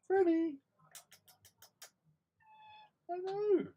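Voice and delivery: very high sing-song call to a cat. Real, not acted. Owner calls the kitten out from behind the chair, very high.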